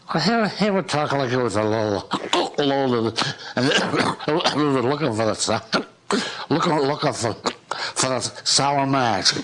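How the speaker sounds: put-on drunken bull. Shown as a demonstration. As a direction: slur it like a tipsy bull. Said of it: "Do you think you can do the voice of a drunken bull?".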